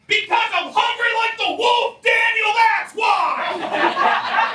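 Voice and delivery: high, furious scream. Retold or imitated. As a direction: scream it at the child. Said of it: "screams at the top of her lungs".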